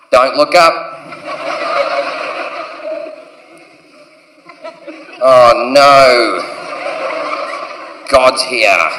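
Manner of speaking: low, drawn-out dismay; dreading warning. Acted. Partygoer spots God arriving at the party, low dread. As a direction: warn friends in a low, sinking voice.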